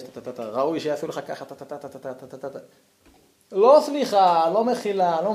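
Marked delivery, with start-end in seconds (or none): 0.0-2.6 mumbled insults. Acted.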